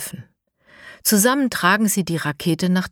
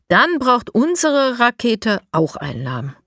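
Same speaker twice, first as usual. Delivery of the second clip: raised, eager call; 6 semitones higher, 4 dB louder than usual. A narrator's reading. Lara calls to the friends building the rocket. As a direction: call it out to the group, a bit higher. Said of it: "ruft Lara".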